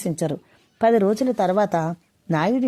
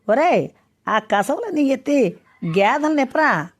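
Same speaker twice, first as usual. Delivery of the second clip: thundering order; 6 semitones higher than usual. A narrator's reading. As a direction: order the boy around harshly. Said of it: "అన్నాడు ఉరుముతూ". (ఉరుముతూ గద్దించే ఆజ్ఞ)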